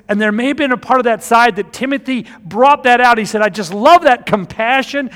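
fired-up sermon delivery; preaching at full force. Acted. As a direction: preach it out to the congregation.

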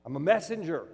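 loud, high-pitched preaching; fervent pulpit preaching; preaching at full force. Acted. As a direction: drive the point home to the congregation. Preacher rising into forceful delivery.

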